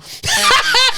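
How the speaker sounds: very high, forced fake laugh; hammed-up phony cackle; fakest laugh ever. Shown as a demonstration. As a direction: laugh too hard to hide tears. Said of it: "doing the fakest laugh ever".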